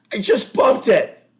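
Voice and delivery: high, slow defensive yell; hammed-up indignant excuse; acted loud protest. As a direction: protest loudly, stretching the words. Acted answer to shrieked accusation.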